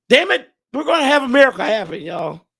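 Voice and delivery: fired up. Real, not acted. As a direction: pump the crowd up. Rallying fans to the end.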